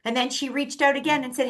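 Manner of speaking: ordinary talk, nothing marked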